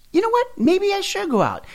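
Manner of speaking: giddy temptation. Retold or imitated. Tempted dieter agrees to cocktails with coworkers, giddy and high. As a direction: squeal it like you just can't resist.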